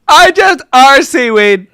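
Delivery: slow, high mock shout; exaggerated mock reading. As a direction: blurt the misread line, loud and drawn out. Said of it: "that's how I read that".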